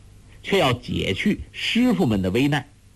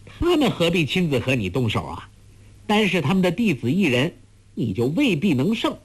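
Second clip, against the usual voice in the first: lightly voiced girl, lilting and higher; taunting girl; 6 semitones higher, faster than usual. A narrator's reading. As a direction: needle her into a contest.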